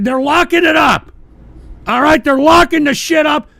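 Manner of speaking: high, loud outraged yell; ranting yell about the locked-up butter; outraged shout. Real, not acted. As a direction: shout it in disbelief.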